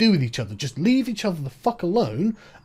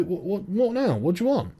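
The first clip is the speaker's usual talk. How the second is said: normal talking voice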